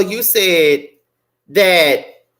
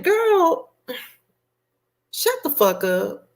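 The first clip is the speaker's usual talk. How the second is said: dismissive; 11 semitones higher, 3 dB quieter, slower than usual. Retold or imitated. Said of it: "And I'm like".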